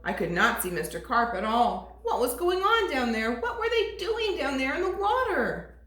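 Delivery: plain reading voice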